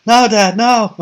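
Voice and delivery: loud, high mock plea; mock desperate appeal to dad; pleading kid. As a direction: cry out as a protesting son, hammed up.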